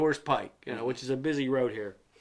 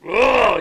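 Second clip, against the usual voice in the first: a loud caveman grunt; dumb grunt; 7 semitones higher, 11 dB louder than usual. Retold or imitated. Johnny Bravo imitated with a loud dumb grunt. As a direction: grunt like a meathead. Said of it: "He's like".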